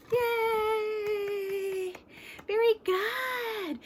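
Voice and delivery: high cheerful praise; excited. Real, not acted. Cheering the children.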